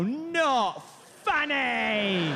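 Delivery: very slow dismissive "Not funny." again in the British star voice; curt verdict. Retold or imitated. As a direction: drag it out like a bored rock star. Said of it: "pants go".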